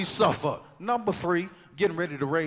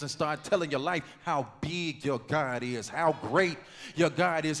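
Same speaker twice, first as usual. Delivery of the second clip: ordinary delivery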